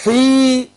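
حي with the long ee held out; drawn-out madd vowel. Shown as a demonstration. Teacher isolates the ya of madd, حي held long. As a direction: stretch the ee of حي well past normal length. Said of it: "حروف المد وهم جمعوها في كلمة".

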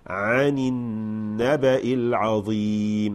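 عن النبأ العظيم with a full kasra and a gentle move on. Shown as a demonstration. Teacher demonstrating a full but gentle kasra. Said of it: "نحقق الكسرة كاملة لكن ننتقل بلطف".

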